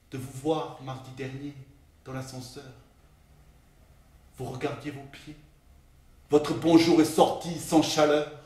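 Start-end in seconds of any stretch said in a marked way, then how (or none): 0.1-5.4 very soft, subdued recital; hushed sorrow. Acted. Colleague recalling a painful elevator meeting, hushed. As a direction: let the voice sink low and quiet.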